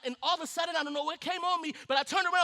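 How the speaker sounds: ordinary talk, nothing marked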